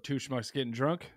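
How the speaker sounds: usual speaking voice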